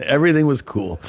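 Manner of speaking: said through a laugh; laughing while repeating the tease. Real, not acted.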